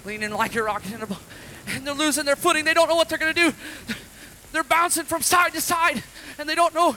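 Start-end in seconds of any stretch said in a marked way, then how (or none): 1.7-6.9 louder, higher, driving narration; building storm telling. Acted. Preacher telling children of the storm, loud and rising. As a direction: whip the kids up into the storm. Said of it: "preacher builds storm story".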